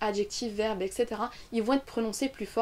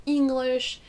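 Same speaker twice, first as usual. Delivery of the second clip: ENGLISH with a long, high first syllable; stressed content word; 3 semitones higher, 4 dB louder than usual. Shown as a demonstration. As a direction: stretch the ENG in ENGLISH. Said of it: "qui vont ressortir".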